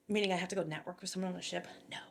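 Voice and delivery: fast, voiceless inner panic; panicked whisper. Retold or imitated. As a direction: whisper it like a secret you're scared of. Panicked aside about the chief's order.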